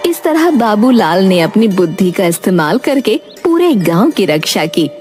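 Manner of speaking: plain reading voice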